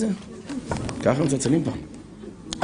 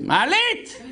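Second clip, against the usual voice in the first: much higher, shouted call for the lift; grandma shouting; much slower than usual. Retold or imitated. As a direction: yell "מעלית!" up high with a laugh. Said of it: "אז היא צועקת". (סבתא מרוקאית צועקת למעלית, בקול גבוה)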